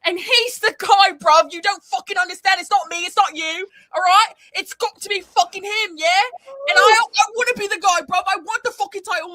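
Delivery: loud, worked-up British brawler rant; aggressive British wrestler promo. Retold or imitated. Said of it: "do the Gabe".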